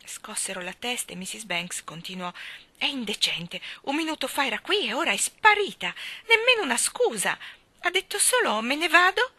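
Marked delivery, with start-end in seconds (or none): 2.8-9.3 high, loud outraged complaint; indignant tirade. A narrator's reading. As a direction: pile up the accusations, dragging out the last. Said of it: "Sono furibonda".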